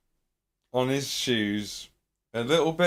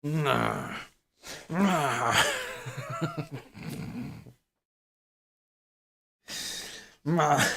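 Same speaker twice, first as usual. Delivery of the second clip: zombie noises; 3 semitones higher, 7 dB quieter, much slower than usual. Pretend. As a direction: moan like a zombie. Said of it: "making zombie noises at you".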